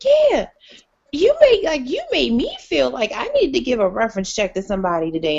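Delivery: high, laughing coworker; coworker teasing. Retold or imitated. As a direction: tease the professional tone. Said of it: "She's like".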